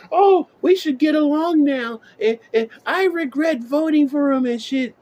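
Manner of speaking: high, whiny mock apology; high mocking crocodile-tears whine; mock whining regret. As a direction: whine it like a fake-sorry voter. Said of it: "cry crocodile tears now".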